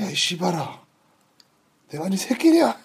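menacing villain swearing at a doctor; Choi Min-sik voice. Retold or imitated. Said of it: "최민식이 악마를 보았다 해서".

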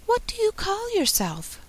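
high, gentle little fawn voice; soft, sweet fawn. A narrator's reading. Fawn asking Alice her name, soft sweet high voice. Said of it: "Such a soft, sweet voice it had".

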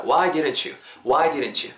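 "didn't you" joined into "디른츄"; linked pronunciation. Shown as a demonstration. Teacher repeating the linked form, "didn't you" fused. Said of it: "이렇게 붙는다는 거".

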